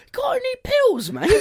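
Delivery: very high, mock drunk friend; high-pitched pestering impression; Sean. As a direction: imitate a drunk pal pestering, voice way up. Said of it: "and then Sean goes".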